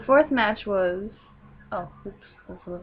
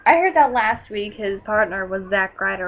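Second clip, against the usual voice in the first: usual speaking voice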